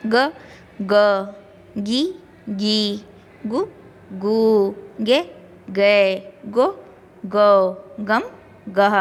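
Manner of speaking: each syllable said clearly, one at a time; reciting the barakhadi. Shown as a demonstration. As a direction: drill ग, गी, गु, गे, गो as a teacher. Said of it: "तो ग की बारह खड़ी किस तरह बनेगी?".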